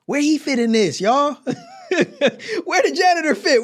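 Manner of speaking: real laughter breaking into the words; laughing bafflement. Real, not acted. Listener asking where the janitor fits in, laughing in bafflement. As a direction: ask it through helpless laughs.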